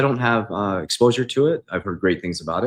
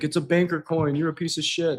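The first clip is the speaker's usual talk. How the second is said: mocking the critics; 8 semitones higher than usual. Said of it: "everybody's like".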